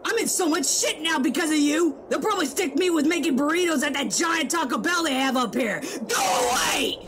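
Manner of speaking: exasperated blaming rant; frustrated character yelling blame; angry rant. Acted. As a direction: chew him out for the mess. Angry character berates the other.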